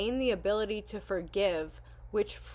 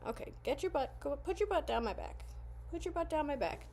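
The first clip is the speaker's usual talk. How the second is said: higher, softer coaxing pet talk; coaxing the dog; 7 semitones higher, 5 dB quieter than usual. Real, not acted. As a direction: coax her gently, up high and soft. Coaxing heavy dog onto lap.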